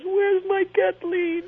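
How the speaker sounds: high, slow mother's voice; mock mother. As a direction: imitate mom missing her real daughter. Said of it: "she called me up and goes".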